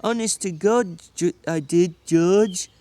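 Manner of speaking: put-on slow, childlike apology; a big simple man pleading with George; Lenny, simple and pleading. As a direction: beg forgiveness like a scolded child.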